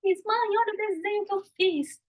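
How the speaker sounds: put-on high, eager child calling mom; high eager child's plea. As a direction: sound like an excited little kid. Child showing drawing to mother.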